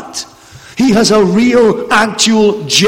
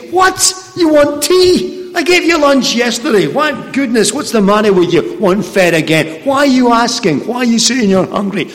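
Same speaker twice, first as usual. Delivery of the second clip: higher, exasperated mother voice; mock scolding mum; 4 semitones higher than usual. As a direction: play an indignant mum scolding a hungry child. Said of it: "your mum doesn't say to you".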